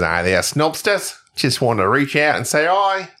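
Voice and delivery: slow, hammed-up Aussie greeting; drawn-out put-on Australian vowels; Australian accent. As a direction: read it in a mock Australian accent. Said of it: "when I do that accent".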